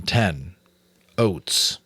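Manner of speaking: ordinary delivery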